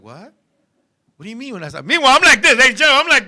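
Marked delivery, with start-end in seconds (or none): 0.0-1.9 low, very soft, baffled question; hushed disbelief. Retold or imitated. Young lover told they aren't starting, hushed disbelief. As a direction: imitate a baffled lover under his breath. His retold baffled reply in bed.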